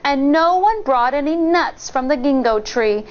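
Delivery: plain reading voice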